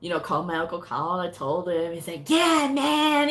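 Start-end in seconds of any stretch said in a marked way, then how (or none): 2.3-3.3 high, loud whoop of congratulations; imitated jubilant shout; uncle's cheer.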